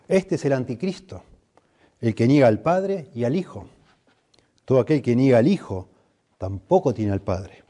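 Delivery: preacher reading Scripture from the pulpit. Acted. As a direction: proclaim the text to the congregation. Reads a Bible passage aloud.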